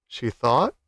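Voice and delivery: ordinary delivery, nothing marked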